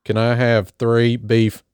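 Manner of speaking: exaggerated country accent, stretched out slow. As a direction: tease with a laughing, drawn-out country accent. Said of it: "sort of country accent".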